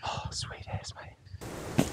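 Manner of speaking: barely there elated whisper. Real, not acted. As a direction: whisper the win under your breath. Whisper after the kill.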